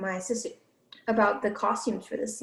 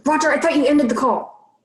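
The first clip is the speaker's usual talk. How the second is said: normal talking voice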